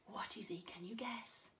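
whispered teasing question; hushed question. Acted. Teacher whispering a riddle to little ones.

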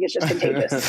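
laughing through the words; giggling, can't hold it in. Real, not acted. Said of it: "I always start laughing".

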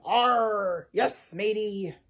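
slow, growled pirate drawl; big pirate voice. Acted. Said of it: "A big voice said".

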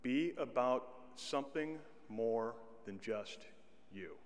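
ordinary talk

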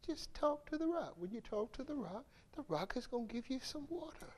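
soft, fast, reassuring instructions; calm him down with quiet reassurance; gentle God. Retold or imitated. God soothing Moses, soft and quick. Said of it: "So God says".

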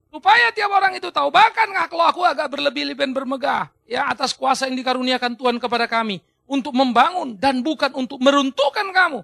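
loud, emphatic quoted proclamation; preacher voicing a forceful letter; forceful declamation. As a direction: declaim it like a bold apostle. Declaims Paulus's letter to congregation.

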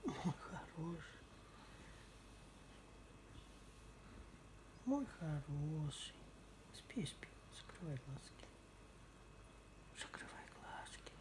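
soft whispered cooing. Real, not acted. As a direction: lull a pet to sleep, barely audible. Lulling the pet to sleep.

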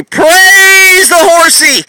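very high, loud, drawn-out cry; mock preacher cry. Buyer praising horse in parody, loud drawn-out cry. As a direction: shout it like a revival preacher. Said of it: "walk up to your horse and say".

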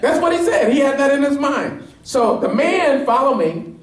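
high, ringing preacher's climb, pressing each point; preaching at full force. Acted. Pastor pressing the congregation on the leper's "If", fervent preaching. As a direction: drive the point home to the congregation.

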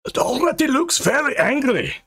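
high-pitched character voice bursting back; high, agitated retort. A narrator's reading. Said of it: "Angus burst back".

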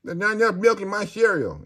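high, loud whiny complaint; a hint of a spazzed-out Rain Man complaint; mock meltdown. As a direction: whine it like a childish tantrum. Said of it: "spazzed out over the simplest things".

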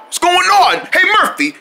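normal talking voice

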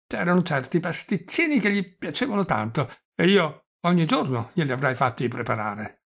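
put-on stammering, chatty old prince; halting old man, catching on his consonants; doddering old prince. Old prince reminiscing to his nephew, doddering and stammering.

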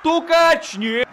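high shout of the name; excited hockey call. Real, not acted. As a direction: call the breakaway loud. Breakaway chance missed. (взволнованный крик имени)

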